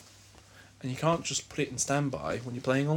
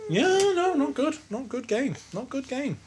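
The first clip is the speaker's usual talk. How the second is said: high squeaky voice; 13 semitones higher than usual. Pretend. Mock disapproval of a game.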